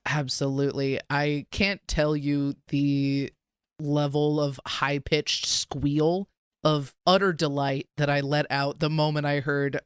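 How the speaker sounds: ordinary talk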